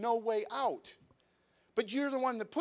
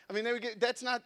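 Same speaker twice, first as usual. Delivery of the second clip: normal talking voice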